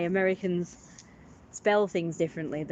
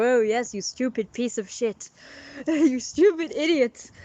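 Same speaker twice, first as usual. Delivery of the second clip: mock insult; 4 semitones higher, 4 dB louder than usual. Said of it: "go up to each other and go".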